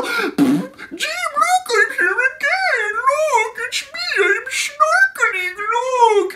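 very high, squeaky falsetto, words stretched out; mock husband in piercing cartoon voice; put-on husband voice. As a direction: squeal for attention like a show-off husband. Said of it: "I'm gonna do a voice that, that's not French".

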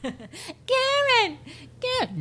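very high falsetto name, fading; playful falsetto repetition; silly high echo. Pretend. Echoing the name bit.

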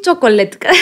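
chocolate in a heavy Greek accent; Greek-accented English. Shown as a demonstration. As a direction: give up and say chocolate the Greek way, laughing. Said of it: "σε κοροϊδεύουνε και εν τέλει να καταλήξεις".

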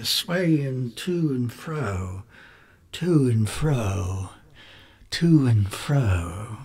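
very slow, soft, drawn-out; slow haunted repetition. Acted. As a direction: let each to and fro sway slowly. Narrator dwells on hanging body.

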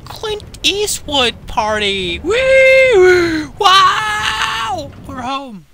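very high, loud, slow whoops; ecstatic cheering. Acted. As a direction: shriek with over-the-top joy. Pushed button, cheering launch.

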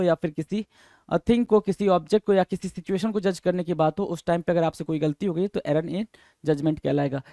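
usual speaking voice

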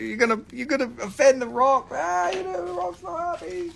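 teasing, as if the Rock himself will be upset; mock warning. Mock warning about offending the Rock.

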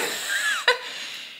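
a short high unvoiced laugh at her own story. Real, not acted. (hoge, ademende lach)

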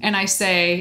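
ordinary talk

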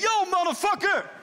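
shouted greeting, pitch jumps high; mock shout. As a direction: bark it out like a rude caller. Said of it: "berichten die begonnen met".

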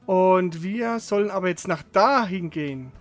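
ordinary delivery

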